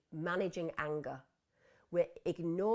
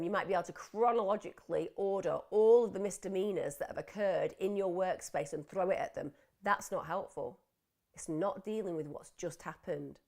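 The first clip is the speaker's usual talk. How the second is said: ordinary talk, nothing marked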